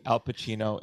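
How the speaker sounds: ordinary delivery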